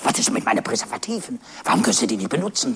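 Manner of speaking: the low clerk, quick and puzzled; low salesman voice. Acted. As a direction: rattle it off low and baffled. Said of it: "sagt der Verkäufer".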